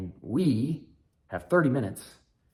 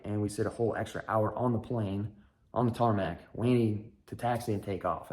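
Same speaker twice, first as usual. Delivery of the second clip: ordinary talk, nothing marked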